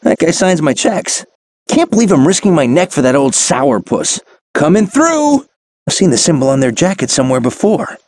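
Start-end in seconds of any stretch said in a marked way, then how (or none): 4.5-5.4 loud, high warning call; high heads-up shout. Acted. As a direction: call ahead to clear the way. Hero calls out while rushing.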